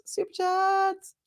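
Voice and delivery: high, slow sing-song call. Acted. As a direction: sing it out like an announcer. Sing-song announcing super chats.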